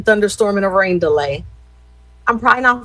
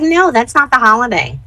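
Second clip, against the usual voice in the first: higher-pitched imitation of a friend; Crystal's talk; 4 semitones higher than usual. Friend turning down a Friday cookout, imitated. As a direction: mimic your friend's firm refusal. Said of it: "That's how she talks".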